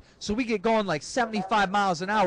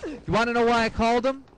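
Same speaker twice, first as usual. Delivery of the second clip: ordinary talk, nothing marked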